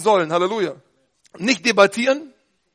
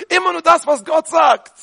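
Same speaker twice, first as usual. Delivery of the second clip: preaching shout; 6 semitones higher, 4 dB louder than usual. Real, not acted. Emphatic climax of sermon.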